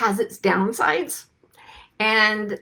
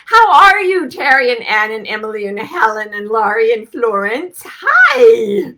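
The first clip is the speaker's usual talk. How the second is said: excited greeting; 4 semitones higher, 10 dB louder than usual. Real, not acted. Greeting named viewers warmly.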